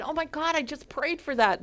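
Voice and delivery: high, excited exclamation; eager, high-pitched retold outburst; retold excitement. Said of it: "They were so excited. I said".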